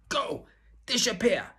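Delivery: high, loud angry outburst; frenzied ringmaster. Acted. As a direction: chase the girl out, furious. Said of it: "he hissed".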